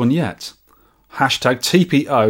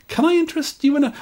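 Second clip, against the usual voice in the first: high, quick, meek sales pitch; timid sales assistant; faster than usual. Acted. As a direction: try timidly to win the customer back. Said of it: "Him to sales assistant".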